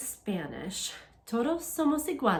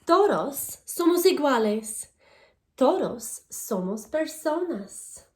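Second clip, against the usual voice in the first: louder, clear storybook reading to a class; reading to children; 6 dB louder than usual. Acted. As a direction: read each page out to the kids, bright and paced. Reads Spanish picture book aloud.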